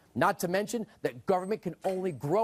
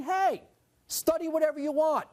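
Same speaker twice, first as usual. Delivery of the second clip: high, breezy sarcastic permission; mock carefree; 9 semitones higher than usual. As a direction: pitch it up in mock easy-going generosity.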